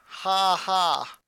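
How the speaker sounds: flat, forced dry laugh; a deadpan ha ha. A narrator's reading. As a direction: laugh without any humor. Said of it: "responded with a dry laugh".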